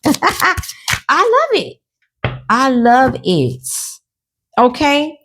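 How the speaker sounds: high, slow, sing-song I love it; delighted. Real, not acted. Reader gushing over her own reading. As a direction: savour each word with glee.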